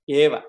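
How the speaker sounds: a loud, clipped "eva" for emphasis; stress on eva. Shown as a demonstration. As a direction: hit "eva" hard to show the emphasis. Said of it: "The emphasis there is in only here".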